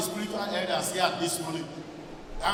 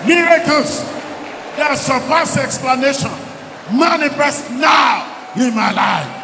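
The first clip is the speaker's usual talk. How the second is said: shouted prayer declaration; 3 semitones higher, 8 dB louder than usual. Real, not acted. Preacher repeats a prayer point to the congregation, shouted. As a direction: hammer out each phrase at full voice.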